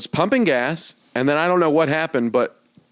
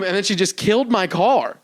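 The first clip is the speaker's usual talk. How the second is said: ordinary delivery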